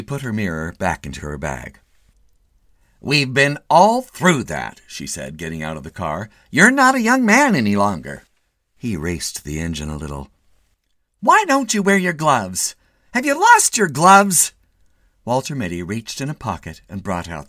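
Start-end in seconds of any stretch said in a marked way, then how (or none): 3.0-4.8 higher, louder, slow wife voice; nagging wife. A narrator's reading. Wife's voice in the story. 6.5-8.2 nagging wife. A narrator's reading. Wife's voice in the story. 11.2-14.5 higher, louder, slow wife voice; nagging wife. A narrator's reading. Wife's voice in the story.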